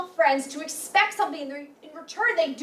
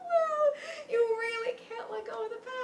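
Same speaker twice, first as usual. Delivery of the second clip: tearful retort; 7 semitones higher than usual. Acted. Girlfriend tearful in the fight.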